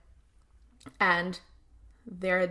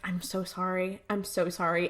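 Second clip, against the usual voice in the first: much softer retold condolence; soft apology; 2 semitones lower, 8 dB quieter than usual. Sam consoling Anna about her parents, soft apology. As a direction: murmur a gentle apology.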